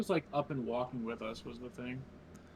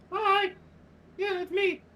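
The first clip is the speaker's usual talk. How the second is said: much higher squeaky little-guy voice; gravelly sly hobgoblin; 5 dB louder than usual. Acted. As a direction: chirp it like a tiny creature. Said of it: "my little cage on my stick and go".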